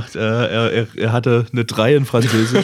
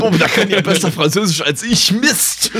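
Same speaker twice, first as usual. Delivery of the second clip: mock dismay; 9 semitones higher than usual. Mocks teacher's dismay.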